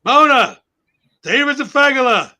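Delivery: loud, high imitated holler to his wife; retold shout across the house; grandpa calling out. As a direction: call out to Mona like an alarmed grandpa, pausing after each name. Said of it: "and he goes".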